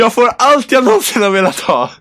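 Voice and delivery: high-pitched, gloating delight; hammed-up glee. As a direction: act overjoyed at getting everything. Acts out delight at keeping him. (överdrivet lycklig, med hög röst)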